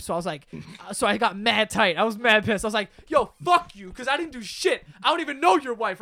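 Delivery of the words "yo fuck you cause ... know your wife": raised, heated retelling of a yell; worked-up mock yelling; retold angry screaming. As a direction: lash back indignantly, short of a real scream. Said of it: "So I'm screaming at him".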